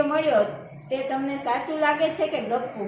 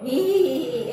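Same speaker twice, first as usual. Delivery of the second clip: hee hee giggle demo; 3 dB louder than usual. Shown as a demonstration. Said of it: "એવી રીતે હસવાનું".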